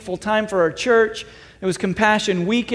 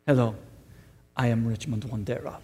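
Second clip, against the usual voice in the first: a lowered impression of a deep-voiced guest speaker; Richmond's deep voice; 11 semitones lower, 9 dB quieter than usual. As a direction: drop way down into a rich, deep, measured voice. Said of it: "I remember his voice is what I remember".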